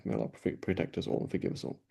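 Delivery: breathy whisper, barely voiced; whispered prayer. Real, not acted. Teacher asks Allah's protection for his students, whispering. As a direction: murmur the plea for forgiveness under your breath. Closing prayer after lecture point.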